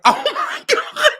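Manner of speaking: high, slow, laughing mock horror; high laughing oh my god. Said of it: "and I was like".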